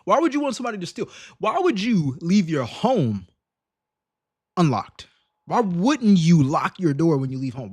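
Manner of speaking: usual speaking voice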